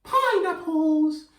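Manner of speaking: very high, slow and stretched; high silly fruit voice for kids; squeaky high pineapple voice. Shown as a demonstration. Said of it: "a voice for the pineapple".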